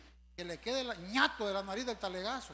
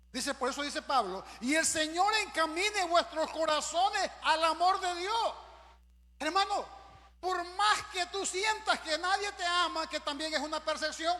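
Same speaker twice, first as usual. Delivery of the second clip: raised, high pulpit voice; full-force preaching; 5 semitones higher, 6 dB louder than usual. Acted. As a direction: declaim the verse, stretching the words. Preacher declaiming verse and appeal.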